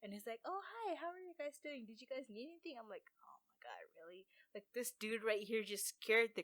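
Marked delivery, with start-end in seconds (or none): 0.4-2.8 high-pitched, chirpy imitated greeting; friendly worker. Worker's friendly greeting, voiced in story. 3.2-4.2 low, hushed retold disbelief; exasperated relief. Exasperated reaction after the scare, retold.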